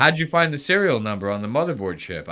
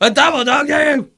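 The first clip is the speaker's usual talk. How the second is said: much higher, shouted parody; mocking mimicry; 8 dB louder than usual. Host parroting the clip's threat, high shouted mockery. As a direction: squawk back the dare to ridicule him.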